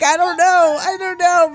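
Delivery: high and loud through tears of laughter; sobbing with laughter, can't cope; laugh-crying. Retold or imitated. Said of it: "I was like".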